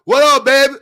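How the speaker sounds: loud, high Southern greeting; Southern accent. Acted. Streamer mimicking the South's greeting, put-on Southern accent. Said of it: "That's how y'all used to".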